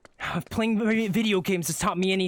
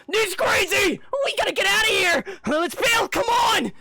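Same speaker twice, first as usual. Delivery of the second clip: panicked retreat; 10 semitones higher than usual. Acted. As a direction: scream to flee. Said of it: "peek out ... in fear".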